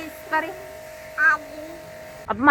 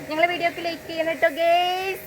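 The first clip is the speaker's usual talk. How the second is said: put-on higher, softer coaxing line for the child to repeat; soft prompt to a child; 5 semitones higher, 4 dB quieter than usual. Shown as a demonstration. Parent softly prompts the child what to tell viewers, higher and softer. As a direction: model the line gently so the little one can copy it. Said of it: "പറ".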